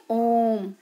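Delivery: ओम with the vowel held long and slow; drawn-out pluta vowel. Shown as a demonstration. Teacher showing students a drawn-out ओम. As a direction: stretch ओम out like a chant. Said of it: "और पलुत स्वर".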